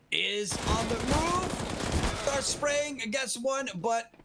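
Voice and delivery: high-pitched hype commentary; excited casting. Real, not acted. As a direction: ride the multi-kill, rising fast. Multi-kill in live play-by-play.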